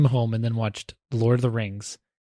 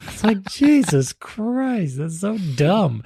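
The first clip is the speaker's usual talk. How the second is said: exasperated; 7 semitones higher, slower than usual. Real, not acted. Exasperated at dumb assistant.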